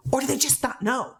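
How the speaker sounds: normal talking voice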